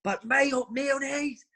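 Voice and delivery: high, slow mock tirade rising with each line; angry manager impression. Manager ranting at players about ketchup and mayonnaise, high mock rant. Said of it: "And he says".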